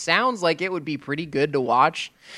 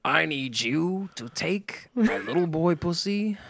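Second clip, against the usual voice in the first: Al Pacino rant; slower than usual. Retold or imitated. Host imitates Pacino's slow build to a big yell. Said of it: "Al Pacino being like".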